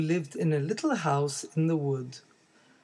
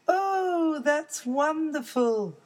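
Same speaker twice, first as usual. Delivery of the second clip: high thrilled little cub; excited baby bear; 11 semitones higher, slower than usual. Acted. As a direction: squeal with delight at snow.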